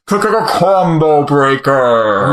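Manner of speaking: slow, muffled stutter through cupped hands. Shown as a demonstration. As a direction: stammer the catchphrase slowly, muffled behind your hands. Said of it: "filter you're putting on your voice through your hands".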